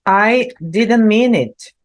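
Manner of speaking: slow, spelled-out I didn't mean it; slow example phrase. Shown as a demonstration. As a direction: give the expression slowly to the class. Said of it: "Isso aqui também é uma expressão".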